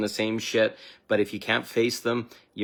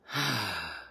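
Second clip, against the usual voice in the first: long sigh; 5 dB quieter than usual. Acted. Releasing tension after shadow work.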